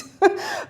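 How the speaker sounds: short high laugh through tears; tearful laugh. Real, not acted. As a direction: laugh it off while almost crying. Embarrassed laugh mid tears.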